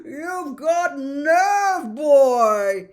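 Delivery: loud, slow, delighted boom. Acted. Slughorn praising Harry before the class, booming loud. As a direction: boom it across the dungeon. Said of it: "he boomed".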